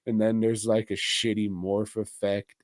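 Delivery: ordinary delivery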